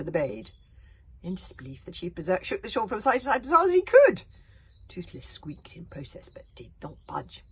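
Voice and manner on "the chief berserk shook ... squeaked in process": usual speaking voice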